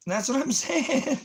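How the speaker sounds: high, slow words squeezed through laughter; laughing at himself while telling viewers; laughing squeak. Real, not acted. Cracking up mid-sentence, high and slow.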